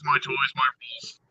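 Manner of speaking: whispered mockery. Mocking the museum guy.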